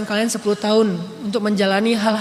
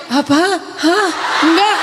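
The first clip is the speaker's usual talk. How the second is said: mock softened voice; 6 semitones higher, 4 dB louder than usual. Shown as a demonstration. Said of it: "nggak dibikin".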